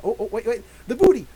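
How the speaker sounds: sudden loud playful cry; excited exclamation. Real, not acted. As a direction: blurt it out gleefully. Excited dance interruption.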